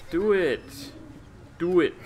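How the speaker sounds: loud, high cheer of do it; shouted urging. Real, not acted. Player yelling at his reluctant companion, loud high urging. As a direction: yell it at him to jump.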